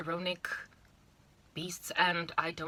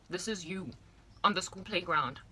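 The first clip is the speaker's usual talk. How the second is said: usual speaking voice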